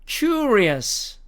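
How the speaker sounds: "curious" with a long yoo; American pronunciation. Shown as a demonstration. Teacher modelling the fourth word, American pronunciation. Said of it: "미국식 발음으로 가면".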